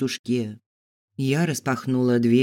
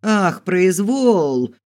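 high, loud, long-drawn mocking cry; outraged yell; 6 semitones higher, 5 dB louder, much slower than usual. A narrator's reading. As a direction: throw the word back as a slow, outraged wail. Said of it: "завопила тётушка". (возмущённый протяжный вопль)

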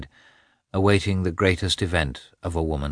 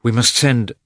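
louder, higher, urgent decision; alarmed urgency; 5 semitones higher, 7 dB louder than usual. A narrator's reading. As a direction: say it in alarmed haste. Frightened husband, urgent to act.

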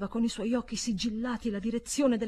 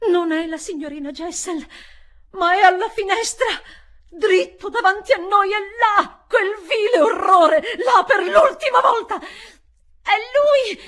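high, loud desperate cry; frantic shout; 12 semitones higher, 13 dB louder than usual. Acted. Governess crying out at the ghost, frantic shout. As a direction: point and scream at the horror.

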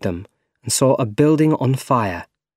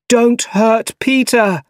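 high, loud, drawn-out shout; shouting after someone; 12 semitones higher, 5 dB louder, slower than usual. A narrator's reading. Harry calling after departing Doc Ock, urgent shout. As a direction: call out urgently, stretching the words. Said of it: "Harry shouted after him".

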